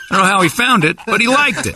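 high laughing words. Real, not acted. Laughing at fan research.

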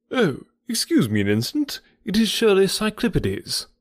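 a hint of a naturalist suddenly distracted, quick and keen; lightly voiced eager naturalist. A narrator's reading. Stapleton spots a moth while talking, a lightly excited naturalist. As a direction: break off mid-talk as if a rare insect caught the eye.

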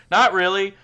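put-on high, loud childish shrug; kid voice. Kid shrugging about the missing dog, high kid voice. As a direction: toss it off like a kid. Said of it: "I'm trying to do a kid voice".

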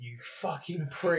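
muttered insult. Retold or imitated. Coach muttering a slow insult at his fighter. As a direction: say it under your breath, dragging it out. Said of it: "Joe fucking turned around and went".

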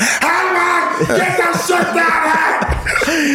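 high, stretched-out comic holler; exaggerated high-pitched shout; screaming through the house. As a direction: yell slowly to someone far off in a big house. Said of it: "You gotta scream through the house".

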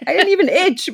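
high mock-innocent reply; high innocent Lila. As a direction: answer all innocent. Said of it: "She's like".

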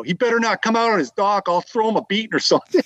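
half-shouted threat toward the dock, retold for laughs; lightly hammed-up rowdy Boston fan hollering; loud exclaim. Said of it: "rather loudly exclaimed".

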